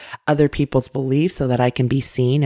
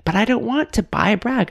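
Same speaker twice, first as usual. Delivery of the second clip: put-on higher, childish protest; whiny inner child; 8 semitones higher than usual. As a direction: whine it like a sulking kid who won't pay. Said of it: "And I'm like".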